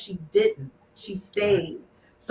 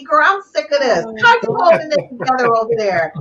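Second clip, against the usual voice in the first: normal talking voice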